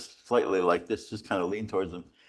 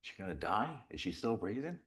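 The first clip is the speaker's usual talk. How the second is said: hushed worried murmur; 10 dB quieter than usual. Retold or imitated. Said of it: "sitting there looking like".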